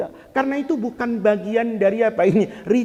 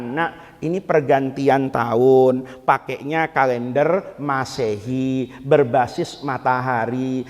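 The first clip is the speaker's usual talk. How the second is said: low, slow, patient teaching voice; parent explaining gently; 9 semitones lower than usual. Acted. Parent teaching children about the calendar, low patient explanation.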